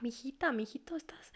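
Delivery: put-on soft whispered coaxing to a child; gentle whisper. Parent gently approaching an upset teen, whispering. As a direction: lean in and whisper the pet name. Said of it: "en lugar de castigar".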